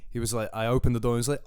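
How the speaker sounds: usual speaking voice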